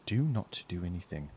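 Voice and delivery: low, soft command; hushed, held-back order; quiet warning. Acted. Woman looks up to warn the dwarf, quietly. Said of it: "the woman looks up at him".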